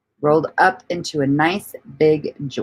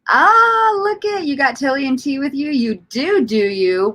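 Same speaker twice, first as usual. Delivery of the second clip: excited surprise; 10 semitones higher than usual. Real, not acted. Streamer reacting to what a viewer has with them, excited. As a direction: burst out high and thrilled. Spots viewer's news in chat, delighted.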